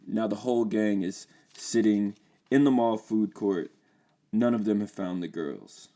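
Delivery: ordinary delivery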